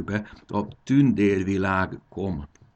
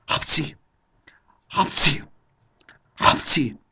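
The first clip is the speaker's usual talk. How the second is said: three high, loud mock sneezes; acted sneezes; 8 semitones higher, 6 dB louder, slower than usual. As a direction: sneeze sharply, one after another. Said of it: "Apró tüsszentések sorozata hangzott fel". (eljátszott, apró tüsszentések)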